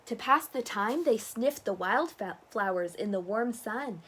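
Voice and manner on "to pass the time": usual speaking voice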